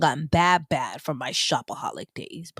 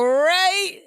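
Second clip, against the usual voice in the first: indignant shout; 12 semitones higher than usual. Real, not acted. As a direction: fire back high and indignant.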